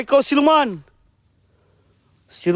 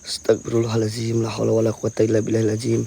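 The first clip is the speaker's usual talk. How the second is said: nervous night vlog whisper; 13 semitones lower, 5 dB quieter than usual. Real, not acted. Berdoa pelan karena takut.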